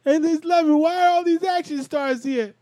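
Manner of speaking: high, loud, excitable Tracy Morgan impression; a high, loud Tracy Morgan bit. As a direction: pitch it way up and push it out like Tracy Morgan. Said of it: "'cause I'm Tracy Morgan, y'all".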